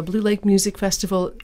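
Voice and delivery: usual speaking voice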